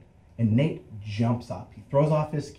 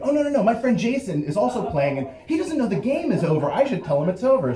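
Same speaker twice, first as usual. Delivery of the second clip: high, rapid over-explaining; flustered excuse; 9 semitones higher, 3 dB louder, faster than usual. Acted. Teen explaining his missing friend to cop, flustered and fast. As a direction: rush to sound innocent, pitch up.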